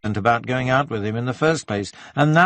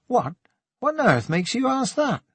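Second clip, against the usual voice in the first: high-pitched, stung reaction; jolted into a sharply higher pitch; startled; 12 semitones higher than usual. A narrator's reading. Conran reacts to a question about being in love, startled. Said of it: "Conran started as if he'd been stung".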